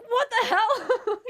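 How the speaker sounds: high, loud, slow laughing outrage; laughing disbelief. Retold or imitated. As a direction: exclaim in amused disbelief.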